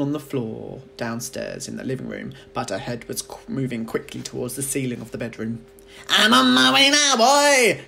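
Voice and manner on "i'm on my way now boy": much higher, louder cackling crone whoop; a wild old witch crowing with glee; screechy old grandma shout. A narrator's reading. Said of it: "she called down to George".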